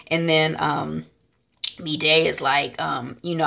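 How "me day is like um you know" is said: usual speaking voice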